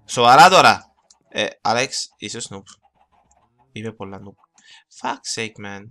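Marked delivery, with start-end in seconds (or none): 0.0-0.9 loud, exasperated burst; exasperated outburst. Real, not acted. Gamer fed up with his own mishap, exasperated outburst.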